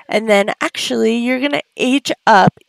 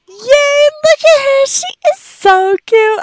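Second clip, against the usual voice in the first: cooing delight; 6 dB louder than usual. Acted. Cooing at her Sim Ellie after the birthday. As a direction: melt over how cute she is.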